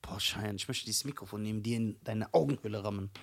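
low, quiet, exasperated grumble; deadpan mock threat. Real, not acted. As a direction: deliver the threat low and under control. Mock threat after hearing the title.

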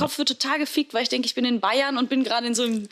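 usual speaking voice

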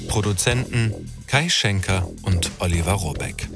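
ordinary delivery

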